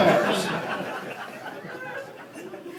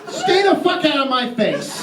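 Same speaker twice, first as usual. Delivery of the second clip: shouted higher at the bureaucrats; louder retry; 8 semitones higher than usual. Acted. Comic yelling at politicians to stay out. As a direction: really yell it this time. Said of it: "Hold on a second".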